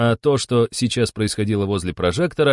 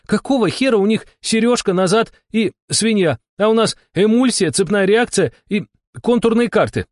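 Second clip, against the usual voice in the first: high-pitched outraged rant; indignant shout; 12 semitones higher, 3 dB louder than usual. A narrator's reading. Shama comparing her cards to theirs, outraged. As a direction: complain at the top of your pitch. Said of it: "крикнула она".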